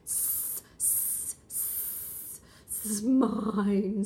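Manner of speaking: long unvoiced hiss before a loud mine; hissing snake. Snake claiming the egg in the story, hissing. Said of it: "says the snake".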